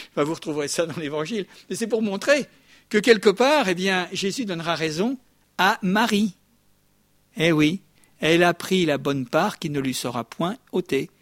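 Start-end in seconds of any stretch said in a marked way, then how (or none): none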